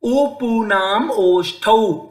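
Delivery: slow ऊपूनाम ओष्ठौ with lips rounded; sutra recitation. Shown as a demonstration. Teacher recites lip sutra. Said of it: "आगे".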